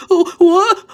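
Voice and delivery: sudden high, loud startled yelp; hammed-up gasp of disbelief; mock shock. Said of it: "I don't go".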